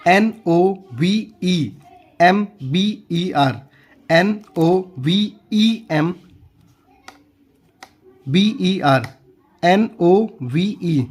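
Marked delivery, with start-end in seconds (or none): none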